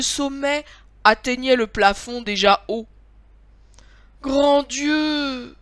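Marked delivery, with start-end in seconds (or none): 4.2-5.6 higher, louder cry of dismay; dismayed exclamation. A narrator's reading. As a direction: exclaim in drawn-out alarm. Master reacts to tall tree.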